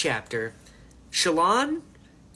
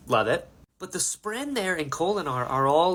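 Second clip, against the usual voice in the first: plain reading voice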